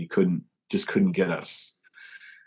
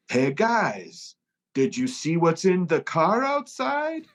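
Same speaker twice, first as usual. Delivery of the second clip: higher and slow, over-eager bait; fake innocent question; 9 semitones higher, slower than usual. Tour manager attempting the trick on the band, high and slow. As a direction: try too hard to sound casual. [name] trying his own trick.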